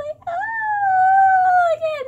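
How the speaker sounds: real overwhelmed crying; happy crying wail. Real, not acted. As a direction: let it break into a sob. Overcome again.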